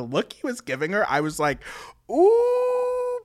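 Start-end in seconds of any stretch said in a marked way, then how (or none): 2.1-3.2 long, high "ooh"; scandalized ooh. Retold or imitated. Retelling Andy's look at Linda, scandalized. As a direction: let out a sustained high ooh, as if watching trouble brew. Acts out own reaction to look.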